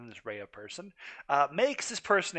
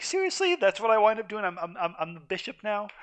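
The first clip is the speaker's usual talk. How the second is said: imitating a stunned reaction, much higher and louder; a hammed-up what, me?; mock disbelief; 7 semitones higher, 6 dB louder than usual. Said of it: "I was like".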